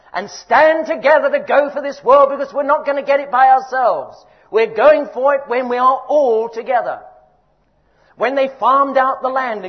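full-voiced sermon rally; rousing preaching. Real, not acted. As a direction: drive it home to the whole church.

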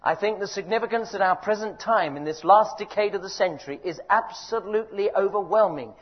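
ordinary delivery

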